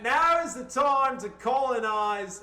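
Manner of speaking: put-on a bungled Aussie accent meant as British; mock Aussie accent. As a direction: boom it in an overdone Aussie accent. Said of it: "fucking Aussie accent".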